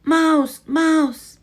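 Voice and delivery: high, loud alarmed shouts; shouts of mouse. Acted. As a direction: yell the alarm. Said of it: "shouts of".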